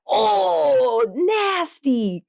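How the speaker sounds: high, grossed-out groan of disgust; mock revulsion while running; disgusted face. Runner pulling a face mid-run, hammed-up disgust. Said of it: "make this, like, super".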